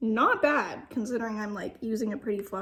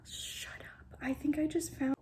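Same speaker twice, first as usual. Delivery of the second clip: whisper; 3 semitones higher, 4 dB quieter than usual. Real, not acted. Whispered disbelief.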